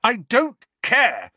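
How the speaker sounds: high, loud, slow yell; furious teenage yell; angry yell. A narrator's reading. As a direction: hurl the words at the portraits. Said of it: "Harry yelled at them".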